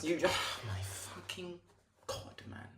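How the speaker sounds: breathless, voiceless despair; despairing whisper. Real, not acted. Fan gives up on his team, whispering in despair. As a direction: let it collapse into a whisper.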